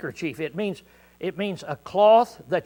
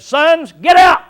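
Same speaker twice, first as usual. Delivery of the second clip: a much higher, louder yell; father's shout; 10 semitones higher, 14 dB louder, slower than usual. Retold or imitated. Oliver yelling at his boys in the car. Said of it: "But if he looked out there and said".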